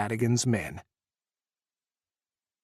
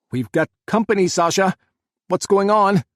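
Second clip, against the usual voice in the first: loud, higher urgent yell; 7 semitones higher, 7 dB louder than usual. A narrator's reading. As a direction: shout over the gunfire. Jack yelling during gunfire.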